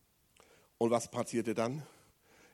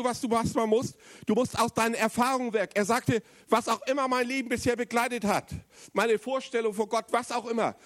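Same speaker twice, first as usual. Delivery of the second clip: raised, urgent preaching voice; 8 semitones higher than usual. Acted. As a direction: drive the point home to the congregation. Renewed preaching at force. (eindringliche Predigt)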